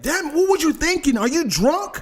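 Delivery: high accusing voice of the other driver; imitating an accusing driver; mock accusing driver. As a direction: demand it like a suspicious stranger.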